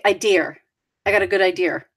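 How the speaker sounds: "idea" ending in an R, like "idear"; added R. Shown as a demonstration. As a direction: tack a New England R onto "idea".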